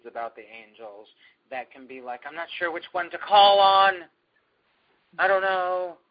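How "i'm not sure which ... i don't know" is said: loud, raised voice of someone at a loss; exaggerated clueless dithering, higher and louder; mock helpless uncertainty. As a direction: act out a person who can't decide, pitched up and loud. Mimics people unsure whom to call.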